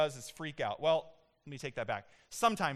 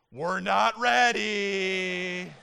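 louder, very slow drawn-out call; parents calling back; 3 semitones higher, 8 dB louder, much slower than usual. Retold or imitated. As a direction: call back from the bedroom, stretching it. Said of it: "Tiffany and I go".

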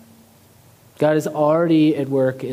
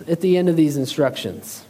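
normal talking voice

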